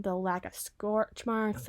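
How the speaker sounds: ordinary talk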